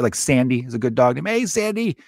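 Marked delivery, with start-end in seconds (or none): 1.2-2.0 very high, singsong doggy call. Acted. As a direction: call your pup way up high. Calling a dog by name.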